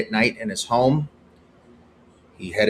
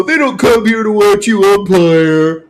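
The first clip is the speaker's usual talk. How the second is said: high, loud jeering fan voice; mocking heckler; 10 semitones higher, 9 dB louder, slower than usual. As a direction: jeer like a heckling crowd. Mocking fans heckling umpire.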